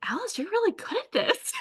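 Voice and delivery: lightly voiced husband's encouragement; encouraging husband.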